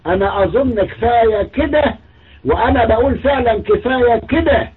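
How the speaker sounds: high, loud mocking whine; mock exasperation. Mocking the host giving up, high loud whine. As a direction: mimic him throwing up his hands, high and loud. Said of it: "وردد الكلام ده بعد شوية وقال".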